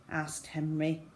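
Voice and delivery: ordinary delivery, nothing marked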